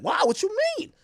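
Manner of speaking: high, feigned amazement; mock surprise. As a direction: act shocked.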